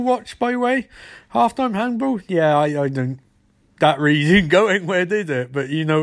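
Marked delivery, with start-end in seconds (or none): none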